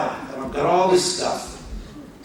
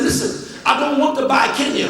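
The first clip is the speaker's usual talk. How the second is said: exasperated retort; 7 semitones higher than usual. Retold or imitated. Arguing with immigration officer.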